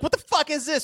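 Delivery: high-pitched indignant squeal; mock outrage. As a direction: play it as a cafeteria meltdown. Acted lunchroom meltdown.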